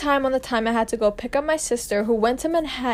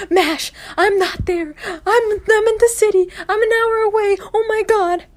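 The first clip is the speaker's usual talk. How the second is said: mock panic; 10 semitones higher, 4 dB louder than usual. Sister confessing she's an hour away, mock panicked. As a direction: imitate a panicking sister in a much higher voice.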